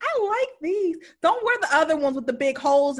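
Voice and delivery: high-pitched mock motherly approval; imitated fussy mom. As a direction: pitch it up like a pleased, fussing mother. Mom praising earrings over gauges.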